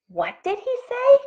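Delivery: very high-pitched, baffled question; astonished cry. A narrator's reading. Frogs hear a pig say ribbit, astonished cry. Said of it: "cried the frogs".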